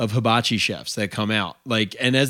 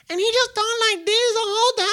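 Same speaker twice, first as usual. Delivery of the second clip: put-on much higher, fast squeaky anime girl; faster than usual. Copying the honeymoon hibachi chef, a high anime girl voice. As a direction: chirp it like a cartoon girl. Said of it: "high pitched, almost anime girl".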